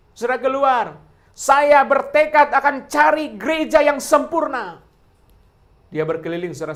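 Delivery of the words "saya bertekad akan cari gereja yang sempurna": raised, loud and slow, mimicking a determined churchgoer; hammed-up vow of a church-hopper; mock resolute vow. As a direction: declare it like a stubborn seeker. Churchgoer's vow quoted in sermon.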